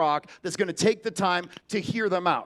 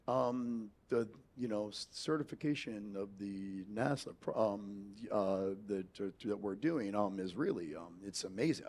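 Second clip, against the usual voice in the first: lower, quieter, halting voice full of ums; Elon Musk impression; 11 semitones lower, 12 dB quieter than usual. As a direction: stammer like a shy tech billionaire. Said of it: "have you ever seen an interview with Elon Musk?".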